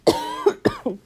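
sharp hoarse cough; rough throaty cough. Real, not acted. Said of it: "or coughing".

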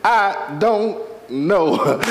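"I don't know" stretched out word by word; very slow, deliberate. Shown as a demonstration. Preacher repeats it so they hear it, "I don't know" very slow. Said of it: "I'm gonna say it like this".

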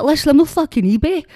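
high, loud mock yell at customers; retold shout. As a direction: bark it back like you've had enough. Snapping back at impatient customers.

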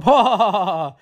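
imitated gloating laughter; mock laugh. Retold crooks laugh after beating the crime family, mock gloating.

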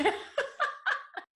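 squealing breathy laugh; high laugh. Real, not acted. After telling mom's punchline.

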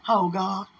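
ordinary delivery

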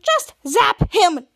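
mock impatience, each word separately punched; exasperated order. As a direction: cut in with a loud, stretched-out command. Impatient order cuts off speech.

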